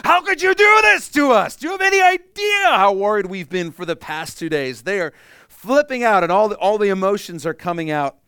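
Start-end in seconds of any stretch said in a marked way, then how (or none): 0.0-4.8 shrieked, much higher and louder scolding; furious Mary. Pretend. Mary scolds Jesus after two days, shrieking. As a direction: scream the worry out at him. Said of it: "They're like".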